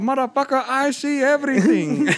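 high mystical genie voice. Retold or imitated. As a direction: boom it out like a genie. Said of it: "he sound like a goddamn genie".

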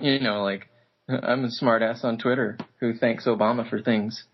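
ordinary talk, nothing marked

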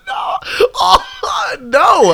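high laughing cry of no; shocked shout. Real, not acted. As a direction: yelp out a horrified denial.

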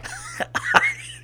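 very high, breathy laughing fit; real wheezy laughter; helpless laughter. Real, not acted. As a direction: collapse laughing after the punchline. Cracks up after the punchline.

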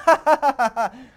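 slow, laughing through the words; cracking up. Real, not acted. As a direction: still laughing at the story.

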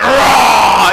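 loud mock roar; big playful beast roar; monster roar. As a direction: roar to scare a child. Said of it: "and I went".

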